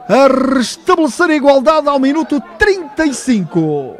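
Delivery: loud, high, drawn-out goal call; fast dramatic football commentary. Real, not acted. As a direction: ride the goal excitement, stretching names. Commentary right after the goal.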